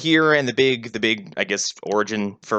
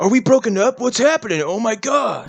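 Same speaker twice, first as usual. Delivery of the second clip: mock airhead panic; 9 semitones higher than usual. Cohost mocking the boyfriend's confusion, high airhead squeal. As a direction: squeal it like a clueless teen.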